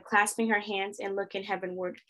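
usual speaking voice

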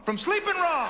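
high-pitched indignant yell; exasperated outburst. Acted. Exasperated outburst in acted exchange.

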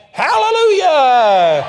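long, high, very loud holler; mock praise shout. Preacher imitating players who messed up, mock praise shout. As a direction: stretch it into an over-the-top cheer, insincerely pious. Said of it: "they may not have been sincere".

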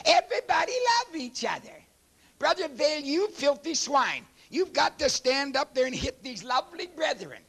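high mocking whine of the peace crowd; mocking whiny voice. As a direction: whine it out in mock pity.

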